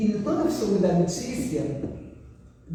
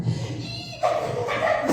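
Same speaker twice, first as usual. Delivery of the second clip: excited daughter; much slower than usual. Retold or imitated. Filha eufórica imitada.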